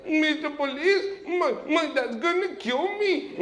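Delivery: put-on scared teen begging the cop for protection; pleading to police. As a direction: beg the officer, half laughing.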